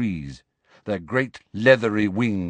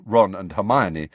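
plain reading voice

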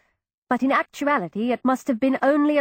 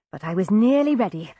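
ordinary delivery

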